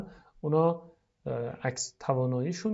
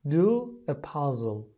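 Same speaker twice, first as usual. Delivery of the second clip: slow model phrase; much slower than usual. Shown as a demonstration. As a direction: stretch each word for the class. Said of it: "لطفاً تکرار کنید".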